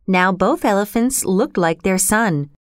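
ordinary delivery, nothing marked

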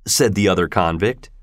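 plain reading voice

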